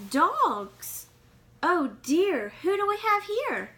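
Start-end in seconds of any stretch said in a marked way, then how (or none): none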